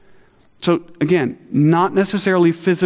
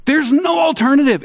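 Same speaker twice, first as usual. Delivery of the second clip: distressed cry; 12 semitones higher, 3 dB louder than usual. Retold or imitated. Voicing a child's distressed cry.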